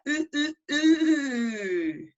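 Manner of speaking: repeated "ooh", the last one long and falling; short ghostly hoots, then a slow wail; wee ghost. Shown as a demonstration. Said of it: "That's your ghost".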